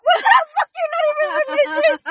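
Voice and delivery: high loud shocked outburst. Retold or imitated. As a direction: blurt it out in disbelief. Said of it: "I'm like".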